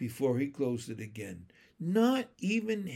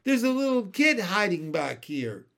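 loud, higher-pitched schoolboy announcement; raised voice, high and urgent; boy exclaiming; 6 semitones higher, 6 dB louder than usual. A narrator's reading. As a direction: call out a surprise find to the playground. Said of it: "exclaimed a boy's voice".

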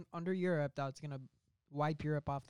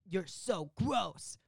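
disgusted; 4 semitones higher, slower than usual. Retold or imitated. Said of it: "Ryan Gosling will be like".

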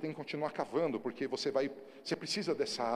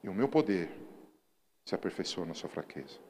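preaching; 4 semitones lower than usual. Acted. God's words, falling to quiet.

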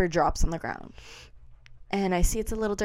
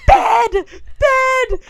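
high, loud wailed demand; mimicked tantrum shout; demanding toddler imitation; 9 dB louder than usual. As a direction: cry out like an upset toddler.

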